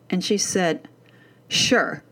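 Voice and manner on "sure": mock teen's dismissive "sure"; sarcastic brush-off. Daughter brushing off mom's chore question, sarcastic brush-off. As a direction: imitate a kid brushing mom off. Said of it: "It was a sarcastic brush off".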